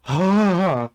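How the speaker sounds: loud, high, drawn-out exasperated sigh; exasperated outburst sigh. Real, not acted. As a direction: let out a big, loud huff of despair. Exasperated outburst after the rant.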